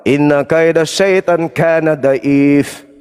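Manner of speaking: slow chanted Arabic recitation; measured Quran chant; Quranic recitation. Acted. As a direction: intone the verse slowly, as in prayer.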